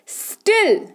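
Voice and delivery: "स्टिल" said alone in one push; one syllable. Shown as a demonstration. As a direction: say "स्टिल" as a single isolated syllable. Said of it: "एक ही बार में निकल रहा है".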